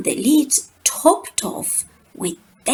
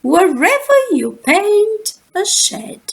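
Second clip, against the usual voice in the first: put-on much higher, louder old lady; high Grandmama voice; 10 semitones higher, 4 dB louder than usual. A narrator's reading. Grandmama after the banana falls, high voice. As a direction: squeak it out like a dotty grandmother. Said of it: "she said".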